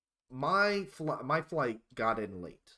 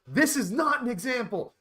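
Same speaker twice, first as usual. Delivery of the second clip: scolding; 10 semitones higher, 5 dB louder than usual. Retold or imitated. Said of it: "he looks back at us and he goes".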